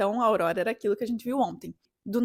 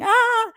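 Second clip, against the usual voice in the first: a short high shriek, much louder; mock scream; 12 semitones higher, 9 dB louder than usual. Shown as a demonstration. Showing friends a scream at the aurora, high and loud. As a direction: demo the scream she did not let out. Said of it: "não gritava assim".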